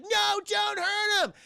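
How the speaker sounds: high, slow shout of no, don't hurt him; mock pleading shout. Eric shouts at the police to spare the family, high, slow yell. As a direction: cry it out high and drawn out, trying to stop the cops. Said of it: "He's like".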